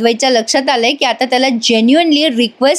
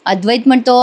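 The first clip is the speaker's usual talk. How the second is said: usual speaking voice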